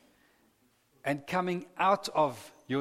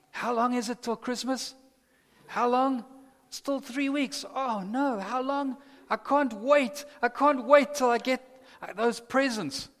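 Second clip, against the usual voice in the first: put-on high, impatient child's voice; 8 semitones higher, 3 dB louder than usual. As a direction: whine like a kid waiting for Christmas. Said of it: "like a child".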